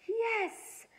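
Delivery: high joyful yell; shout. A narrator's reading. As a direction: cheer it out. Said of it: "she shouted".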